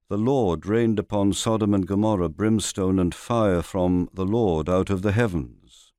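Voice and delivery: ordinary delivery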